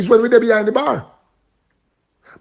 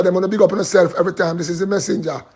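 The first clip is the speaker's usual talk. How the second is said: ordinary talk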